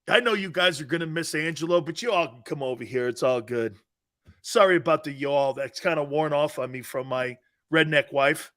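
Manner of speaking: ordinary delivery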